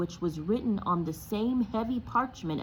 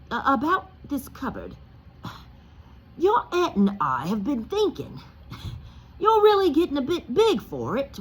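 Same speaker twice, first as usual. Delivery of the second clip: higher, louder strained friendliness; forced niceness; 8 semitones higher, 6 dB louder than usual. Acted. Uncle Vernon to Harry, strained fake friendliness. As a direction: sweet-talk through a painful smile. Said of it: "forced his face into a smile".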